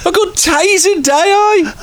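high, crying mock of the drunk fan. Mocking the fan's words.

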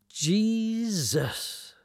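high, drawn-out cry of amazement; amazed exclamation. Acted. Rider stunned as the old bike fires, amazed. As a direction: stretch it out high, awestruck.

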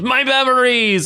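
high, drawn-out mock cry; retold scream. Retelling Logan falling to the ground, mock scream. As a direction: mimic an anguished scream without full volume. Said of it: "He screams".

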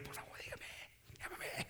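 very soft, voiceless imitation of begging; barely audible playful pleading; mock faint plea. Preacher teasing with someone's plea to be called, faintly.

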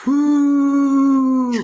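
mock forceful mouth exhale. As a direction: mimic the trendy open-mouth exhale with a hammed-up whoo. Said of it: "do the".

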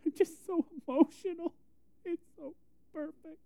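high, soft tearful squeak; hammed-up choked-up voice; mock weepy. Crier explaining his tears to puzzled people, mock weepy. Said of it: "you know I'm a crier".

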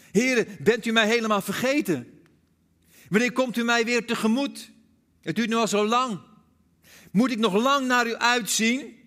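loud, high desperate plea; pleading psalm cried aloud; crying out to God. Acted. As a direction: cry out like a man abandoned by God. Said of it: "schreeuwt u het uit".

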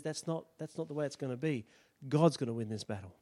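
settled, firm refusal; quiet resolve. Acted. David refusing Saul's armor, soft and low.

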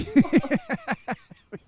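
high, loud burst of laughter. Real, not acted. Laughing at their own dull Facebook pages, high real laugh. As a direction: laugh hard at your own remark.

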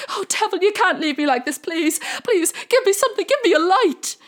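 high, loud begging cry; desperate plea. Acted. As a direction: cry out to the devil for mercy. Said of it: "and called out".

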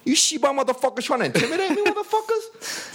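위협하는 흉내; mock tough challenge. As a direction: taunt the goons, stretching the final insult high. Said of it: "I'm like".

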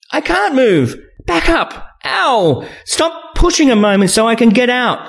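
high-pitched urgent protest; pained female character. A narrator's reading. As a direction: plead to be let out, far up in pitch. Trapped woman pleads in pain.

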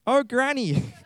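put-on high girlish voice, falling tune. Reader corrects to the girl's line, high girlish voice. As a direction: pitch it up as a young girl. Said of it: "no, wrong voice".

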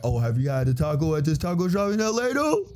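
climbing, fast, ending in a very high squeal; mock LA foodie. Imitating an LA taco fan pestering a friend, mock-eager. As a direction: rush upward to a squeaky, eager finish. Mimics LA food fans one-upping.

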